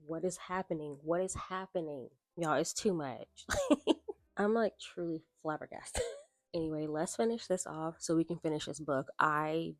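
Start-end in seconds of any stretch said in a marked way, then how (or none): none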